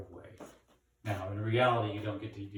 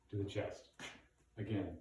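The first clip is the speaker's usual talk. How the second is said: normal talking voice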